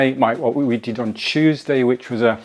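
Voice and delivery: ordinary talk, nothing marked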